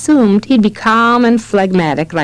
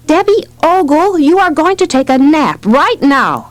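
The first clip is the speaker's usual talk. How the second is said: higher-pitched exasperated mom laying down the law; higher, insistent mother's order; mild motherly pronouncement; 7 semitones higher than usual. A narrator's reading. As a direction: pronounce it firmly, like a fed-up mother. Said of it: "she pronounced".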